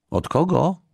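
put-on high-pitched little girl's voice; tiny thin voice. A narrator's reading. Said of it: "usłyszał nagle cieniutki głos". (cieniutki dziewczęcy głosik)

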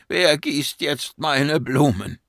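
high, drawn-out lament; dismayed, high-pitched call; crying out. Acted. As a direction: wail the question slowly. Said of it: "rief Monin".

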